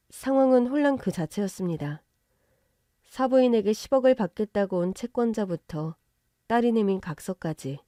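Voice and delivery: plain reading voice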